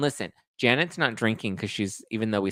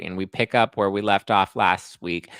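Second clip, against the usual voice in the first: ordinary delivery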